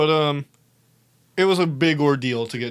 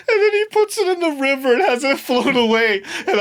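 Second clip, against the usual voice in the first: much higher and louder, laughing through the words; laughing hard; 11 semitones higher, 5 dB louder than usual. Real, not acted. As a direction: crack up while telling it. Laughing at the memory.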